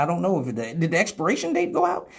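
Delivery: ordinary talk, nothing marked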